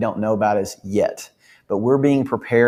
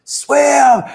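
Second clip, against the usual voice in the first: loud, high, drawn-out shout; urgent sports-fan yell; 7 dB louder than usual. Retold or imitated. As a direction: holler it at a swimmer on the screen. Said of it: "I'm yelling at my T V".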